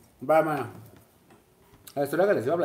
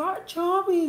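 high, slow cry of shock; retold horrified call to Chavi; much slower than usual. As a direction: call out her friend's name in horror. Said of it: "Le dije".

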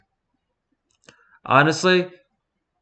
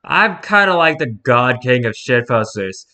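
ordinary talk

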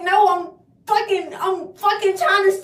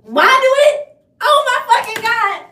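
exasperated; 5 semitones higher, 10 dB louder than usual. Real, not acted. Hair keeps misbehaving.

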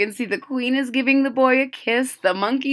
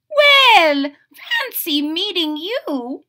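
queen's voice; 6 semitones higher, 4 dB louder, slower than usual. Acted. Queen greets giraffe.

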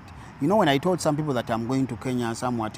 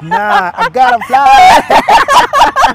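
much higher, louder, very slow mock boast; hammed-up brag; 15 dB louder, much slower than usual. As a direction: stretch it out like a show-off. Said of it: "You were like".

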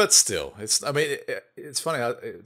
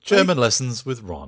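mock announcer; 2 semitones higher, 11 dB louder than usual. Mock show title announcement.

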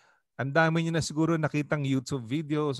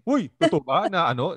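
a blunt, slightly louder, mildly mock serpent question; plain nosy asking, without the sly charm; wrong approach; 4 semitones higher, 5 dB louder than usual. Shown as a demonstration. Pastor demonstrating the wrong way to voice the serpent. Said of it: "Hindi, hindi, hindi ganon".

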